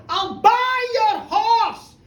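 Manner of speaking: loud, high, drawn-out call; eager shout. Acted. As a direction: shout an eager offer over the crowd. Excited crowd offers to buy.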